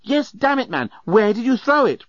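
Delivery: high, impatient outburst; exasperated demand. A narrator's reading. Journalist urging Leovinus to remember, exasperated. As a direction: burst out high, urging him on.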